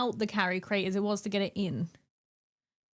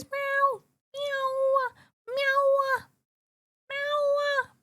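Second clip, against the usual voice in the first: high, squeaky mewing like a small cat; squeaky cat meows; 14 semitones higher, much slower than usual. Retold or imitated. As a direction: mew high and cute. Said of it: "cute, high-pitched, squeaky meows".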